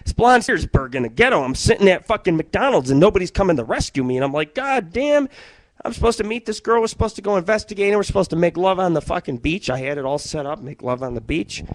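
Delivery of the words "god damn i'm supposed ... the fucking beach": higher, pleading complaint; higher-pitched frustrated grumble to himself. Retold or imitated. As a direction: whine it in frustration. Retold frustrated inner voice.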